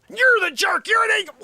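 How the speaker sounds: high, loud, hammed-up yell; mock shout. Host mocking offended people, yelling their insults high and loud. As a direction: yell the insult like a cartoon snowflake.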